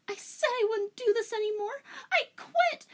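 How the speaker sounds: very high, breaking into mock sobs; hammed-up tearful girl; high crying girl. Rachel pleading to herself over pills, mock crying.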